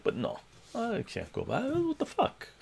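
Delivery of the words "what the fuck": incredulous. Real, not acted. Disbelief at himself.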